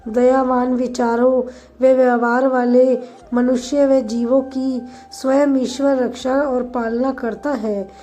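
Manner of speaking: usual speaking voice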